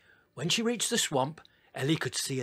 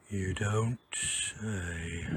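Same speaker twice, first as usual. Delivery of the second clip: slow, gasping, winded words; gasped; 5 semitones lower, 4 dB quieter, much slower than usual. Acted. Ah crawls out of the swamp, gasping. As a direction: drag it out between gasps. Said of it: "gasped Ah".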